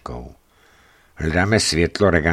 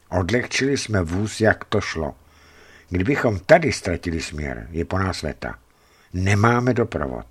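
usual speaking voice

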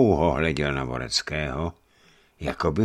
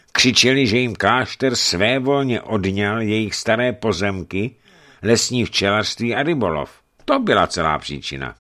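lightly voiced monk, higher and louder; monk's report; 6 semitones higher, 5 dB louder than usual. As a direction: report a grievance with raised voice.

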